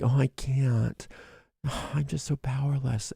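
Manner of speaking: a hammed-up helpless sigh; mock powerless whimper. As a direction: play the defeated victim, sighing. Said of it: "That, like".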